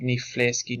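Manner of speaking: ní phléascaigh with phl said as fl. Shown as a demonstration. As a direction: say phléascaigh starting with an F sound. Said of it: "P H creates an F sound".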